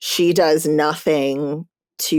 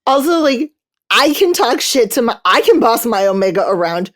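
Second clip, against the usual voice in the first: high, louder gleeful outburst; excited; 6 semitones higher, 4 dB louder than usual. Real, not acted. Cohost gleefully defending the possessive hero, high and loud. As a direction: burst out with glee.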